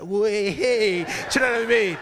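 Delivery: loud gloating cheer; gleeful jeering. Retold or imitated. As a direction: imitate a brother celebrating your trouble. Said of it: "my brother's just like".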